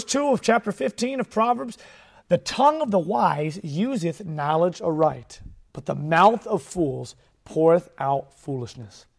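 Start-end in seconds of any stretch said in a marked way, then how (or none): 2.3-9.1 slow, deliberate Bible reading; measured scripture reading voice. Acted. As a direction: read the verse out slow and solemn. Rereads the verse.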